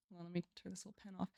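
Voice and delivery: very soft, hurried aside; barely audible, rushed aside to yourself; quiet aside. Real, not acted. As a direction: say it quickly under your breath, half to yourself. Aside while fixing the pen.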